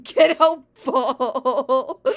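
full-volume drawn-out shout; yelled. A narrator's reading. Luigi orders the chomp away, very loud yell. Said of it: "Luigi yelled".

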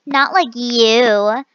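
slow haughty retort; slow snub. Retold or imitated. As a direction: sneer it slowly. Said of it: "And she's like".

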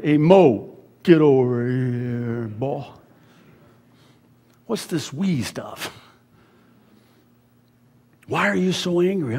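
lightly voiced stern God calling Moses over; God scolding Moses. God summoning Moses to an emergency meeting, mildly stern. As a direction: call him in like a disappointed boss. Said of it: "God calls him".

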